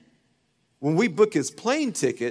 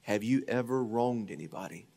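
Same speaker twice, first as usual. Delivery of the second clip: soft voice of God; 3 semitones lower, 6 dB quieter than usual. Retold or imitated. The Lord confronting Kevin, hushed and solemn. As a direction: let God speak gently but firmly. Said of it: "The Lord said".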